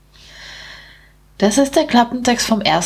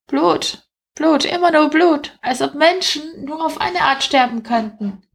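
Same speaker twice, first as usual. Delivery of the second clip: high-pitched rat, mildly exasperated; 8 semitones higher than usual. Acted. Rat grumbling to its mate about blood, high voice. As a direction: complain in a small high rat voice.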